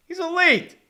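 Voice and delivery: high, emphatic exclamation; emphatic insistence. Real, not acted. Fan arguing for a quarterback, emphatic insistence. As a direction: insist on it, voice jumping up. Mock-insisting Flacco is elite.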